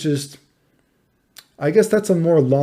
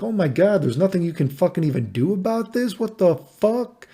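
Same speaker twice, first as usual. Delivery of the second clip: higher, outraged disbelief; 6 semitones higher than usual. Retold or imitated. Reading about untreatable illness.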